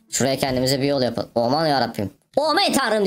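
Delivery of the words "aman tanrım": high-pitched exclamation of mock awe; hammed-up amazement. Gamer exclaims at his find, hammed-up amazement. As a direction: pitch jumps up in showy surprise.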